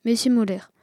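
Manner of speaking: ordinary delivery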